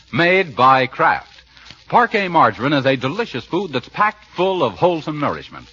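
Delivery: ordinary talk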